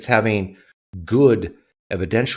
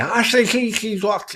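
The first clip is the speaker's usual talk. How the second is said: harsh mock German accent, pitched up; 12 semitones higher than usual. Streamer mocking German as harsh, mock German accent. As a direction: spit out fake German sounds. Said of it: "It's so harsh and terrible".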